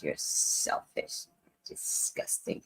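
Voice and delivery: voiceless mock hiss; hushed imitation of the rant; whispered insult. Retelling the insults again.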